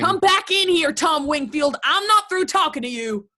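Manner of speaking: high, loud command; angry calling. Acted. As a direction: shout after him as he leaves. Mother calls departing son back.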